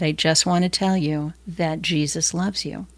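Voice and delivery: slow and deliberate, every word spelled out; carefully slowed-down repeat; really slowly. Retold or imitated. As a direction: take it slow so he understands. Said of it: "really slowly".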